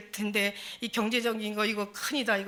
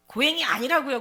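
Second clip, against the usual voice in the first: louder, raised insistence on 고행이; emphatic declaration; 3 semitones higher, 6 dB louder than usual. Acted. Preacher insisting to the congregation, loud and emphatic. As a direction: proclaim it, leave no doubt.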